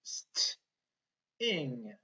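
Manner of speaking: ST as one quick breathy blend, pause, then ING; sounded-out blend. Shown as a demonstration. Teacher showing blend and rhyme, sounded out. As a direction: say ST and ING as separate chunks. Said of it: "we have a blend and a rhyme".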